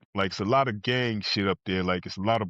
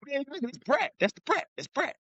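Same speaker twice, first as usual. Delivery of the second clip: excited recognition; 13 semitones higher, 3 dB quieter than usual. Retold or imitated. Jumps up recognizing rapper photo.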